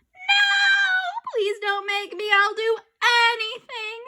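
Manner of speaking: high, slow, crying beg; desperate pleading. Acted. Boy begging not to be married, crying. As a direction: plead like a panicked kid.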